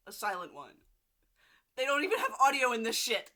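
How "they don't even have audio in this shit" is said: loud, fast, higher outburst; outraged exclaim. Real, not acted. Vlogger rants to viewers about the silent film, loud and fast. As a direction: blurt it out in mock outrage.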